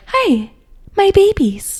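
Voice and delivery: high, sugary-sweet mother voice; mom Duggar voice. Retold or imitated. Sister mimicking a sweet-voiced TV mom to her cohost. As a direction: coo it like a doting mom. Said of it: "the mom Doogar is always like".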